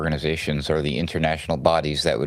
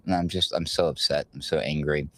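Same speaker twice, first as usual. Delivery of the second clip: usual speaking voice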